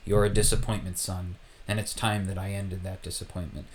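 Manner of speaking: a hint of a stern dark-lord father; lightly voiced Vader, menacing. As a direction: let it drop low and cold at the end. Vader threatening his son.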